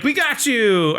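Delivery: high, gloating retold cry; mock triumphant shout. Podcaster as the heroes catching Satan, high mock triumph. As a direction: imitate a gleeful gotcha.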